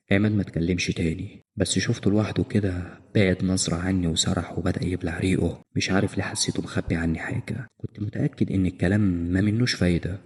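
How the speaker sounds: usual speaking voice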